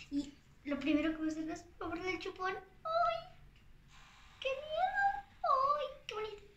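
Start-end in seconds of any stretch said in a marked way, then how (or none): 2.5-6.4 excited squeals. Real, not acted. Pacifier opening, squeals.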